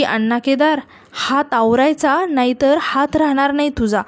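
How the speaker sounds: usual speaking voice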